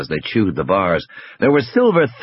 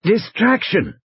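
higher, strained wheezy voice; injured man struggling to speak; weak wheeze; 12 semitones higher, slower than usual. A narrator's reading. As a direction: weakly wheeze the word out. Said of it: "almost a wheeze".

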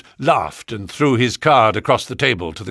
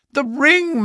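excited realization; 4 dB louder than usual. Acted.

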